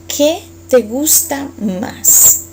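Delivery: very slow, each word apart, x0.5; slow model question. Shown as a demonstration. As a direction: spell it out slowly for learners.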